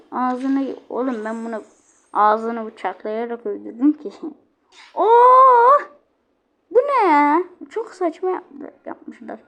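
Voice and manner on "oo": high, loud stretched "ooh"; sudden high exclamation of surprise; high surprised whoop. Real, not acted. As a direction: let out a startled loud hoot. Startled by something in game.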